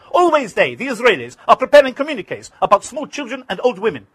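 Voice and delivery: high, loud indignant tirade; angry shouting. Retold or imitated. Arafat shouting at the reporter about the Israelis. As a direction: rail against the accusation. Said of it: "Arafat was shouting".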